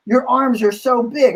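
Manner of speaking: high-pitched fawning admiration; imitated gushing. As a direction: mimic a young fan swooning over muscles. Young men gush over his arms.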